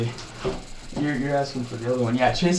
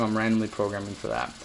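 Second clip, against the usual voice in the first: usual speaking voice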